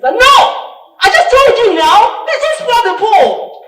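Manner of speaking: high, full-volume yell, re-enacted; shouting. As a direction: yell it at the sister, very loud and high. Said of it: "shouting at my sister".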